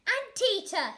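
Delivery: high loud call; sharp shout; yelling. A narrator's reading. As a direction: shout across the garden. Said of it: "he yelled".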